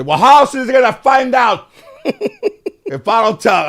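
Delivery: put-on high, loud, laughing old grandma voice; grandmother's retort. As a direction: toss it back like a cheeky grandmother, laughing. Grandmother voiced in family story.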